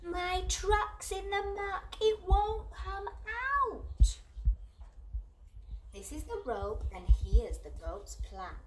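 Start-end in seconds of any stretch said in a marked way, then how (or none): 0.0-4.2 high, slow, loud distant shout; shouting duck. Acted. Duck stuck in the muck shouting for help, high and slow. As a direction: call out for help from far away. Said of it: "hears the shout".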